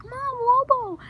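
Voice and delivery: high, loud yell of the dog's name; mock scream, high and loud; screaming to mom. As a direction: shout it to get her attention. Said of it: "I, I scream at my mom".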